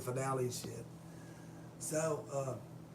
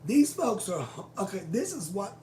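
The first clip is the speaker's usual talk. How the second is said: ordinary talk, nothing marked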